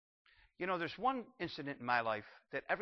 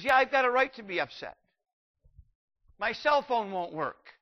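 higher, louder sulky protest; petulant complainer; 7 semitones higher, 7 dB louder than usual. Retold or imitated. Modern preacher griping about his phone, sulky protest. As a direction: pout about a broken phone. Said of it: "So he says".

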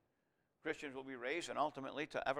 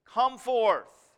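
loud, higher, drawn-out command; loud cry; 8 semitones higher, 10 dB louder, much slower than usual. Retold or imitated. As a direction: cry it out as a summons to the dead. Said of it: "he cried with a loud voice".

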